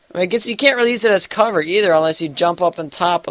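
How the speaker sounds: normal talking voice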